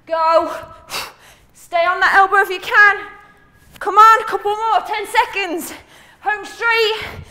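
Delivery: loud, high coach call-out; shouting encouragement. Real, not acted. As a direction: hype them up through the last seconds. Coach shouting encouragement mid-set.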